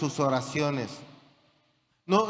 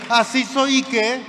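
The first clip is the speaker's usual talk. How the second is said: defiant boast; 6 semitones higher, 7 dB louder than usual. Acted. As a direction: shout it out, loud and high. Proud man defies, preacher climaxing. (desafiante, gritado y agudo)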